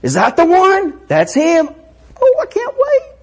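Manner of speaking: put-on high squealing child voice; little girls spotting their dad; thrilled kids.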